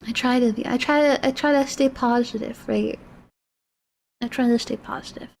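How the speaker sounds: soft, low, fragile self-reassurance; quiet teary voice. Real, not acted. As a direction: hold back the tears while reassuring yourself. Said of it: "not strong all the time".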